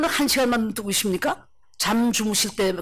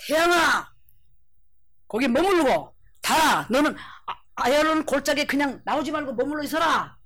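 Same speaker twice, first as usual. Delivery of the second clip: commanding call; 3 semitones higher than usual. Acted. Joshua orders sun and moon to stop, commanding call. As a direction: call out as if to the heavens.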